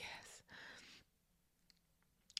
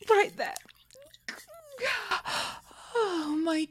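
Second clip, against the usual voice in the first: higher and louder, breathless with pleasure; aroused cry; 11 semitones higher, 6 dB louder, slower than usual. Acted. A mistress crying out with pleasure at her partner. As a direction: let it burst out high.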